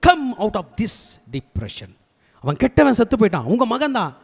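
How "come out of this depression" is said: high, drawn-out command; commanding rebuke. Acted. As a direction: order him out, stretching the words slowly. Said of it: "தாவீது ராஜாவை கண்டிப்பதற்காக". (நீட்டி அழுத்தமாகக் கட்டளையிடும் தொனி)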